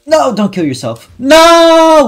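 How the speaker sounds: high pleading yell, building to a scream; dismayed shouting. Real, not acted. Player begging a game character, high dismayed shout. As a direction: yell in dismay, second no much bigger.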